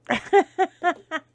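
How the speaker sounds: a sudden bright burst of laughter; real laugh. Real, not acted. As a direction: laugh out loud, high and loud. Laughs about son staying forever.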